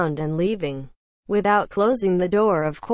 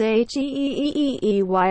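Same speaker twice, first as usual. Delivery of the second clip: mild mock scream; 4 semitones higher, faster than usual. A narrator's reading. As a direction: imitate a scream without raising the volume. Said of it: "My dad just screams".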